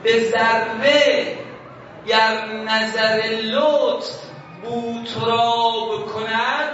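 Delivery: slow, loud recitation rising at the end; recited verse. Retold or imitated. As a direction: draw out each word of the first half-line. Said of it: "این بود".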